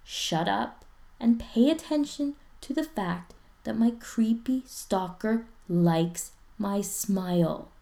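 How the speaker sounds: slow, deliberate, each word weighed; careful slow emphasis; carefully. Acted. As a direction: spell it out slowly. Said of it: "Ryan says carefully".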